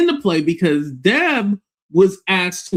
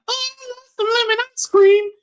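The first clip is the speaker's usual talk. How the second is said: high, soft sweet-voiced lady; high, sweet imitation of a soft-spoken woman. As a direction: mimic a gentle sweet lady. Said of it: "her voice kinda sounded similar to Monica".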